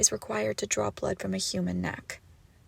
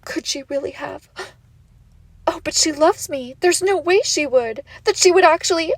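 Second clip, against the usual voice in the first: higher and louder, mock panic; mocking the captive; 7 semitones higher, 9 dB louder than usual. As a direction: parrot the victim's frantic thoughts. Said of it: "Are you asking yourself".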